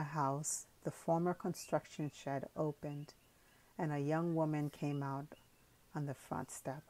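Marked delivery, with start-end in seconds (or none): none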